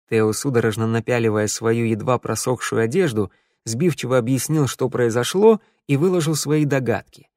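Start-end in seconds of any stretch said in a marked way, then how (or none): none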